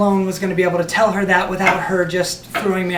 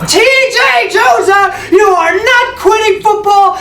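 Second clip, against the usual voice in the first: high, loud, slow furious shout; livid yell; 12 semitones higher, 7 dB louder than usual. Retold or imitated. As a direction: yell his full name in fury. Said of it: "yelling at me".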